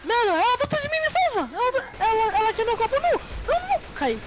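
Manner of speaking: high frantic cries; panicked shouting. Real, not acted. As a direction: yell while being chased. Witch chasing him.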